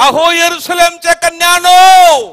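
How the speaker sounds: high, full-force shouted address; loud preacher's call, last word held long. Acted. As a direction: cry out to a whole crowd as a preacher. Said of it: "येशू त्यांच्याकडे वळून म्हणाला".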